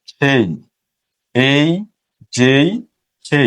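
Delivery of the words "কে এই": ordinary talk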